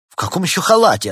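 high, loud indignant question; affronted doctor; offended. A narrator's reading. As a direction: ask as if insulted. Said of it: "оскорбился доктор".

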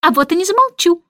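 high, cheeky retort; mock shout from a stubborn bird; defiant cry. A narrator's reading. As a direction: call it out defiantly, pitch up. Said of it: "выкрикнул скворец".

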